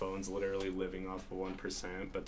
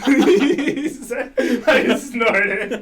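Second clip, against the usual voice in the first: much higher, louder, through laughter; laughing hard; 16 dB louder, much slower than usual. Real, not acted. Guest laughing too hard to talk. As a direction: squeeze it out mid-laugh.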